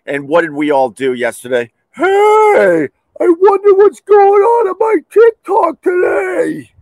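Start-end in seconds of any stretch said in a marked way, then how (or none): 1.9-6.7 very high, loud silly falsetto; mock TikTok airhead. Mocking people glued to TikTok, high loud falsetto. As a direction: squeal it like an oblivious scroller. Mocking what everyone did yesterday.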